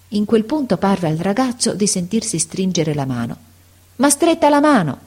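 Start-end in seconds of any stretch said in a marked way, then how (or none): 3.9-5.0 put-on high, startled exclamation from the boy; narrated cry of astonishment, high-pitched. A narrator's reading. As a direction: exclaim it suddenly, pitched well up. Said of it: "esclamò".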